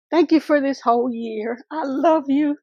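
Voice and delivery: crying through the thank-you; tearful goodbye. Real, not acted. Vlogger thanking her viewers for the year, tearing up. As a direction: voice breaking into real tears, pitch rising. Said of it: "I have gathered myself and collected myself".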